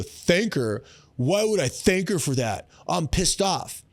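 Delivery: a touch of mock indignation; indignant dad. A dad balking at the advice to thank his daughter, mildly indignant. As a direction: play the dad as lightly indignant. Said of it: "they go".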